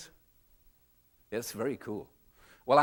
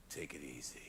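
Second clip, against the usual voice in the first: lazy brain voice; 12 semitones lower, 20 dB quieter than usual. Pretend. Reptilian brain speaking.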